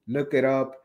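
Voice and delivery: usual speaking voice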